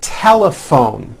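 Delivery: TELEPHONE slowly, PH as a clear airy F. Shown as a demonstration. Teacher modelling PH as F, slow TELEPHONE. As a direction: spell out TELEPHONE by ear, lingering on the F. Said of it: "is also pronounced as an F".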